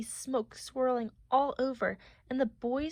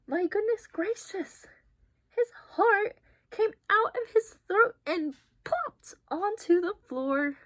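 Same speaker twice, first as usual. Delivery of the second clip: amazed exclaiming; 8 semitones higher, 4 dB louder than usual. Acted. Amazed reaction to picture.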